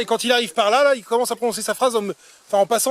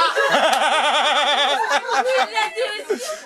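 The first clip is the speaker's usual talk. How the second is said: long laugh; 5 semitones higher than usual. Real, not acted.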